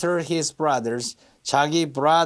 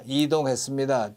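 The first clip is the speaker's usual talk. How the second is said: ordinary talk, nothing marked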